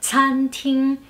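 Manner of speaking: "Cantín" as level tsan-ting with puffed ts; aspirated C. Shown as a demonstration. Teacher correcting the cantina likeness, "Cantín" said tsan-ting. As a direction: open "Cantín" with exhaled ts, never k. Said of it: "muchos dicen que suena parecido a cantina".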